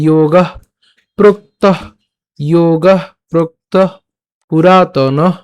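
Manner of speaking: "যোগঃ" ending in an audible puff of h; slow demo of "যোগঃ প্রোক্তঃ" with aspirated endings; breath released on visarga. Shown as a demonstration. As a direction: release warm breath after "প্রোক্তঃ" and "পুরাতনঃ". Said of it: "মুখে যে গরম বাতাস রয়েছে সেগুলো ছাড়তে হয়".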